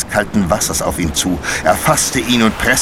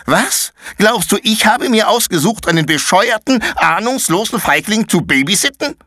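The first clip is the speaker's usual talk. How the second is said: put-on much higher, contemptuous girl's outburst; scornful anger; 14 semitones higher than usual. Paula scornfully insults Konrad. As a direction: sneer at the coward.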